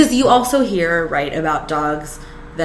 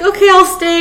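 much higher, louder, yielding okay; high giving-in; 12 semitones higher, 6 dB louder than usual. Retold or imitated. To the needy dog, a high yielding promise. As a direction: give in sweetly to the pet.

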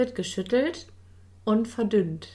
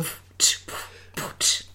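whispering; much slower than usual. Pretend. Mock whispered sound check.

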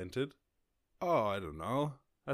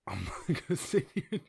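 laughing it off, higher and louder; 7 semitones higher, 4 dB louder than usual. Real, not acted. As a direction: chuckle through the refusal. Laughing at a hard question.

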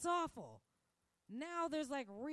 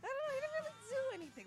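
very high, rapid mock whine; exaggerated high, fast waffling; mock dithering; 5 dB louder, much faster than usual. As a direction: race through a squeaky-high imitation of excuse-makers. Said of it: "that's how they're acting".

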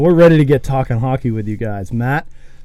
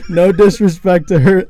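laughing; 5 semitones higher, 4 dB louder than usual. Real, not acted. Laughing apology about the mom.

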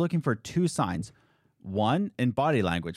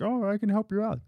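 higher, smug salesman voice; mock entrepreneur voice; 7 semitones higher than usual. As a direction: play an eager hustler offering help. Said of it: "and I'm like".

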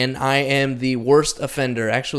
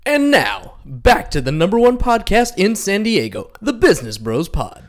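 high-swooping movie announcer; hammed-up, dramatic film-trailer voice; 5 semitones higher than usual. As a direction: bring the show back like a trailer announcer. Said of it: "[name] likes to do his movie voice".

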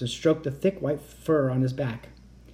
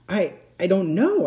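stammering; 5 semitones higher, 4 dB louder than usual. A narrator's reading. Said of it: "I stammered".

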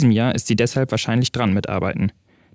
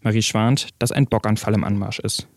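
plain reading voice

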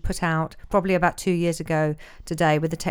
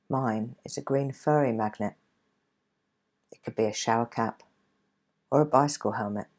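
guided meditation; 3 dB quieter than usual. Acted. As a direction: ease them into calm, gently. Leads a guided relaxation.

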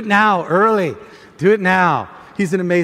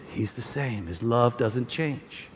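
much softer, slow and low; a quiet echo of the worship song, lower and softer; soft song quote; 7 semitones lower, 10 dB quieter, slower than usual. Acted. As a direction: hush it like a tender lyric. Quoting song just sung.

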